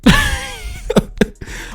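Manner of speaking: high breathy burst of laughter. Real, not acted. Laughing at the women's reply. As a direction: crack up at the point just made. Laughs after mock quote.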